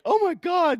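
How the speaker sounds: very high shocked squeal; a hammed-up high "oh my God"; mock shocked gasp. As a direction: squeal like a startled passenger. Plane passengers shocked.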